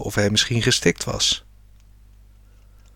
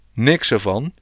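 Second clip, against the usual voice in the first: usual speaking voice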